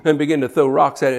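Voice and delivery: normal talking voice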